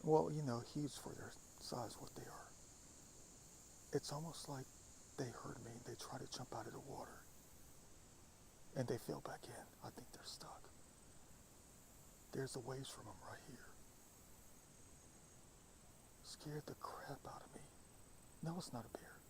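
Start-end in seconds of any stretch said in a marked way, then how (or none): 0.7-2.5 whispering. Real, not acted. Filming animals, whispering. 9.8-19.2 soft unvoiced whisper. Real, not acted. Hushed while watching wildlife.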